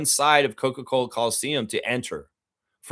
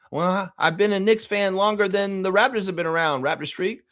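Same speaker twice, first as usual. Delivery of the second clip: higher, lightly voiced smug celebrity; mock Michael J. Fox; 8 semitones higher than usual. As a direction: play the defensive turncoat fan. Imagined Michael J. Fox reply.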